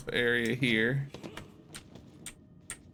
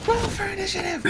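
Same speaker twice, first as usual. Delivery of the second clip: much higher squeaky echo; high mock echo. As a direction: parrot the announcer squeakily. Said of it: "echoing the game".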